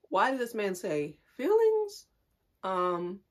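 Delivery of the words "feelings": high-pitched mock query; mimicked questioning. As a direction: echo it back in a high, loud voice. Mimics the ex's questioning reply.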